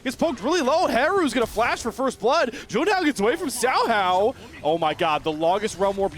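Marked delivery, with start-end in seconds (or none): 0.0-4.3 higher-pitched, excited esports call; animated, rapid-fire fight commentary; live play-by-play. Real, not acted.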